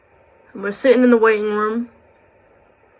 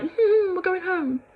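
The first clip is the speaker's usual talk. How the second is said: much higher, whiny little dog voice; voicing the dog; 9 semitones higher, 4 dB quieter, slower than usual. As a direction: speak for the dog, high and pleading. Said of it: "she was looking at my mum like".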